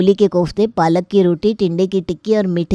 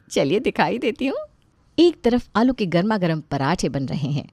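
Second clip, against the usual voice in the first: softer, playful storyteller voice; chatty comic narration, quieter than the characters; story narration; 4 dB quieter than usual. Acted. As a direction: narrate the family comedy to the audience.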